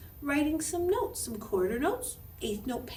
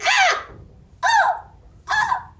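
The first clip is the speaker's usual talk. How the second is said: high, loud shrieks of panic; horrified screams; 11 dB louder, much slower than usual. Acted. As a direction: scream in horror at falling hair. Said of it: "and she went".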